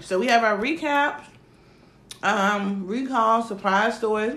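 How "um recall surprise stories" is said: ordinary delivery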